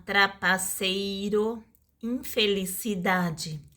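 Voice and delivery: "trapaceiro" and "infelicidade" slowly sounded out; syllable by syllable. Shown as a demonstration. Teacher giving examples of long words, syllable by syllable. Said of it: "de quatro ou mais chama-se polissílabo".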